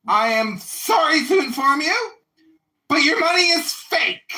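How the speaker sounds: high, loud mock manager; imitating the manager. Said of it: "And he goes".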